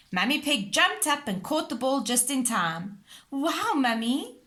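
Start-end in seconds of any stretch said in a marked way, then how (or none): 3.3-4.4 slow, breathy amazed wow; gasping in awe. A narrator's reading. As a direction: gasp in wonder. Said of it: "gasped Peppa".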